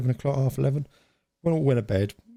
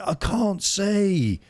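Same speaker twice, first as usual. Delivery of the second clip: high, slow, dazed sleepy cry; high drawn-out sleepy wail; sleep-talking shout; 12 semitones higher, much slower than usual. Retold or imitated. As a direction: mimic a half-asleep teen calling out. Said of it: "he did it again".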